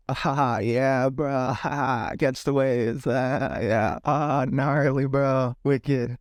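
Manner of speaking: drawn-out SoCal surfer bro voice; SoCal accent. Acted. Vlogger demonstrates how Anaheim locals talk, mock SoCal accent. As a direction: put on a low, laid-back Southern Californian accent. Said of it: "Now, that's a SoCal accent".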